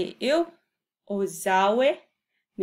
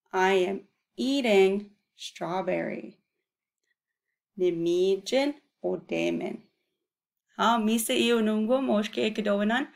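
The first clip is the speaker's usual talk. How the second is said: ordinary delivery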